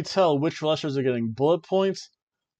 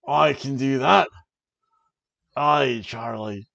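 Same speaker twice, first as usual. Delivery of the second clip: put-on amateur Scottish accent, drawn out at the end; rough stab at a Scottish accent; attempted Scottish accent; 4 dB louder, slower than usual. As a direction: play a Scottish wrestler answering an interviewer. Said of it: "I can't do a Scottish accent".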